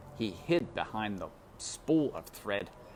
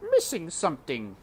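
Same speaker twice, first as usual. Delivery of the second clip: cook tasting; 6 semitones higher, 4 dB louder than usual. A narrator's reading. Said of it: "she said".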